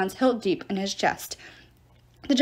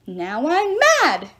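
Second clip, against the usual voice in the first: drawn-out, higher-pitched outburst; slow shriek of fussy fury; indignant shriek; 5 semitones higher, 4 dB louder, slower than usual. A narrator's reading. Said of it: "Terminus shrieked".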